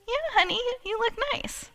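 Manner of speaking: high-pitched placating reply; husband's indulgent compliment, voiced high; imitated husband. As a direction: humour your wife in a light, high voice. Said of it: "He said".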